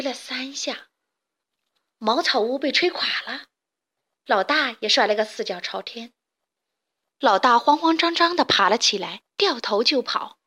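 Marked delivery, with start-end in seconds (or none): none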